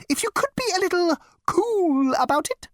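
high, curt bug voice; snapping insect. Acted. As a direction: snap back primly. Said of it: "snapped the fragile little creature".

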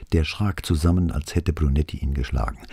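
usual speaking voice

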